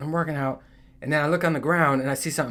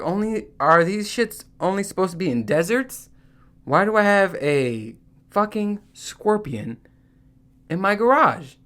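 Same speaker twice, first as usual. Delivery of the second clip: a higher, frantic disbelieving question; mock panic; 6 semitones higher than usual. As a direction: freak out about the scorpion. Panic about scorpion in garage.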